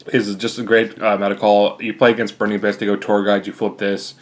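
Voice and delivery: ordinary talk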